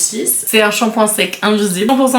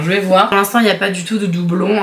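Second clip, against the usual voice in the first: ordinary delivery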